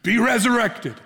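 hammed-up heavenly proclamation; mock grand cry. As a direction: declaim it skyward like a showman. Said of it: "crying out, looking to the heavens".

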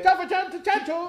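high rattled-off mock Chinese accent; mock chifa accent. Chifa worker listing dishes, high mock Chinese accent. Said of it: "en un idioma chifero".

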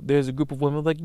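ordinary delivery

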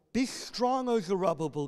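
rally the hearers with each line; ringing preacher voice; preached scripture reading. Acted.

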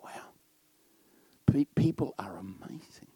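near-whispered wonder; soft, marvelling whisper; hushed awe. Retold or imitated. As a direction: whisper it in amazement. Awed thought about people.

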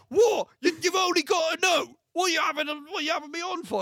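high, loud indignant retort; mock outrage. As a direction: imitate someone outraged, pitched well up and loud. Acting out quarrel with barman.

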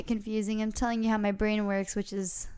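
ordinary talk

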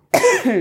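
rough throat-clearing cough; real cough, clearing the throat; chesty cough. Real, not acted. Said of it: "Sorry, ik moet even hoesten".

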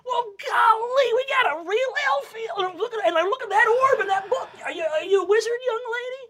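high, bubbly gnome father; excited gnome dad. Acted. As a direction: squeal with delight.

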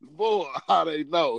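slow, laughing disbelief; cracking up while echoing the line. Real, not acted. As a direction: laugh it out slowly as you echo the line. Laughs retelling Ty's surprise.

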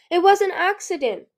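high, loud protest; defensive outcry. A narrator's reading. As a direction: blurt out a defence. Accused character defends herself.

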